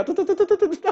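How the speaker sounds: high, laughing tut tut tut of a tongue-tied speaker; stammering tut tut. Retold or imitated. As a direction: sputter the tuts while laughing. Mimics freezing up. (tut tut tut tinggi sambil tertawa)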